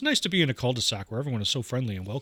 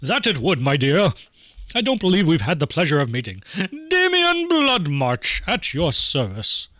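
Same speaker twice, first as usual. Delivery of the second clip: grand, courtly flourish, louder than usual; theatrical gentleman; 4 semitones higher, 4 dB louder, slower than usual. Acted. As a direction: greet a lady with a florid bow, drawing out the name. Voices flourishing, bowing gothic gentleman.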